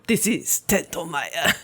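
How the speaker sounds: calm, superior anime rival; cool villain voice. Retold or imitated. Tendo Maya quoted.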